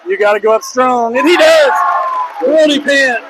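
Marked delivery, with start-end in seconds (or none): 1.2-3.2 rising shout as the shot drops; real play-by-play shout; excited shout. Real, not acted. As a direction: call the basket like a champ just scored. Buzzer-beater call, crowd moment.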